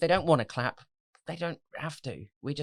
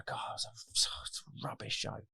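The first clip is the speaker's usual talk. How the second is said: a hushed, deflated whisper; whispered self-talk; 7 dB quieter than usual. Retold or imitated. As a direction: whisper it to yourself after a bad gig. Performer muttering after bad gig.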